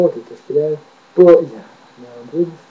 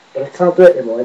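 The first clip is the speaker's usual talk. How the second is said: ordinary delivery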